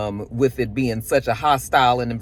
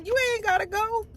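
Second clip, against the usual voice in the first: mock high Big Ron. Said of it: "he tell him".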